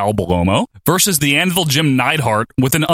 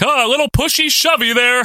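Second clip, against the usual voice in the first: mimicking Dick Graham; 10 semitones higher than usual. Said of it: "Dick Graham's like".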